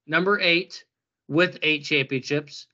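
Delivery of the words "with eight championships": usual speaking voice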